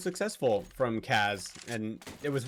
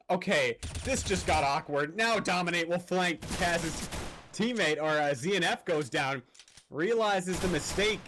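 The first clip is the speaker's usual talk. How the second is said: play-by-play; 4 semitones higher than usual. Acted. Live play-by-play of the round.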